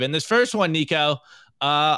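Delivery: ordinary talk, nothing marked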